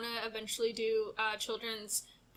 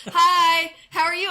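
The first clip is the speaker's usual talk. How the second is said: cheery loud worker; 7 semitones higher, 12 dB louder, slower than usual. Retold or imitated. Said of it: "One guy was just like".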